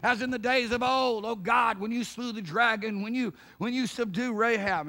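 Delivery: high, loud scriptural declamation; crying out to God. Acted. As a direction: proclaim it as an ancient plea. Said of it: "it's like Israel of old".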